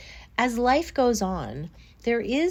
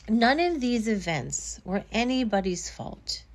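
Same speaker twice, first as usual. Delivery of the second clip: usual speaking voice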